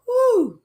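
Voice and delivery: loud high whoo; high whoop. Real, not acted. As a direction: whoop with delight. Overcome with excitement.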